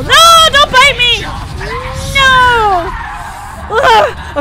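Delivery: high, loud pleading shrieks; panicked screaming. Real, not acted. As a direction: scream as if being bitten. Being bitten by the boss.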